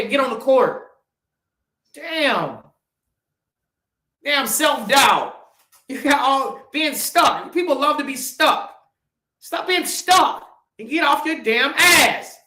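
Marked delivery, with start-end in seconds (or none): 1.9-5.4 cursing self-doubt with a shout; shouted curse. Real, not acted. 9.4-12.4 slow, louder and higher command; ordering viewers to get moving, loud; urgent rant. Real, not acted. Rant climax.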